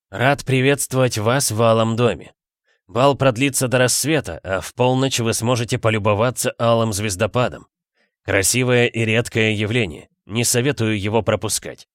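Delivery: ordinary delivery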